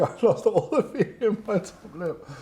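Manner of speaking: laughing through the words. Real, not acted. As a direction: say it while cracking up. Repeats line for camera, laughing.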